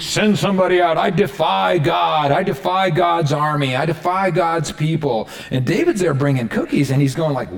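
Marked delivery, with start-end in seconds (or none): 0.0-5.3 taunting challenge. Acted. Goliath taunting the frightened Israelite army, defiant. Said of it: "he's taunting them".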